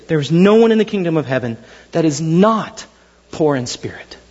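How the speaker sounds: ordinary talk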